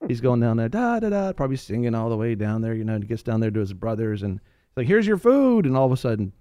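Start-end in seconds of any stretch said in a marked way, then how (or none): 4.9-5.7 high, loud, bright-eyed delivery call; cheery young David. Retold or imitated. Young David handing bread to his brothers, high and eager. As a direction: sing it out like an eager kid brother. David voiced arriving with food.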